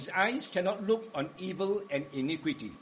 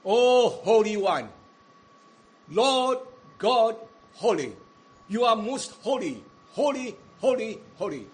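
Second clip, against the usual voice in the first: fervent prayer; 3 semitones higher, 7 dB louder than usual. Acted. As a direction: proclaim God's holiness with full force. Prophet's prayer voiced in sermon.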